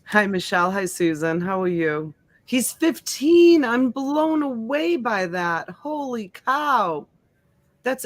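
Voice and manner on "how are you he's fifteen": ordinary talk, nothing marked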